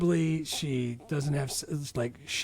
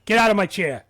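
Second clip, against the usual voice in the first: loud, higher movie-dad bark; raised-voice dad impression; imitating Mel; 5 semitones higher, 7 dB louder than usual. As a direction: order someone out like a gruff dad. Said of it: "say it like him as best as I can".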